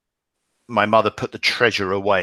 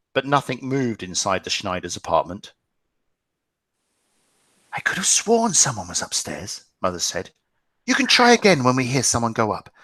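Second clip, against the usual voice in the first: lightly dramatic read-aloud to a class; story narration; 2 semitones higher than usual. A narrator's reading. Teacher reading the novel to the class, narrating. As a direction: read the story to the students, gently building.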